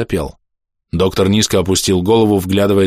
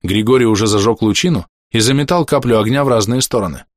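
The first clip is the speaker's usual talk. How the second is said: plain reading voice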